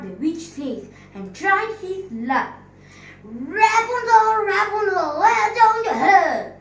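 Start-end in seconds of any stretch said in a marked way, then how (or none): 3.2-6.6 high, loud call up the tower. Acted. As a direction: call up to the window. Prince imitates the witch's call.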